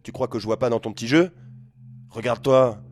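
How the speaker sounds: loud, rising angry tirade; furious father scolding drunk son; losing his temper. Acted. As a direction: blast him with anger. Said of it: "Il s'énerve".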